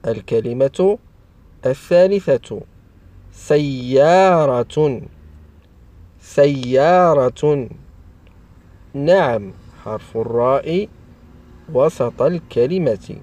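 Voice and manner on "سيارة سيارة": slow, drawn-out dictation of a word; slow enunciation. Shown as a demonstration. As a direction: stretch each syllable for young learners. Teacher sounds out example word. (نطق بطيء ممدود للتعليم)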